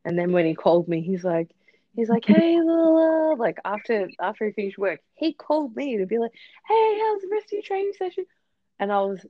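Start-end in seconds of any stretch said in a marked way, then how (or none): 2.2-3.3 high, loud sing-song greeting; lightly mock a carefree phone hello; breezy caller. Imitating boyfriend calling after work, breezy sing-song. 6.7-8.2 high-pitched imitation of a breezy caller; cheery caller. Imitating boyfriend asking about training, cheery and high.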